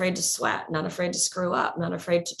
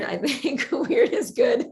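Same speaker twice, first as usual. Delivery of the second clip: laughing; 11 semitones higher, 3 dB louder, slower than usual. Real, not acted. Laughs while saying it.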